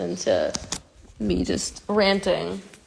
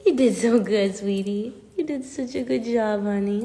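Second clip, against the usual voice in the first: tender pet praise; 3 semitones higher than usual. Real, not acted.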